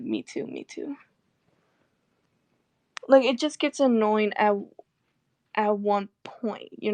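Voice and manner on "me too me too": deadpan agreement. Retold or imitated. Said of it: "I'll be like".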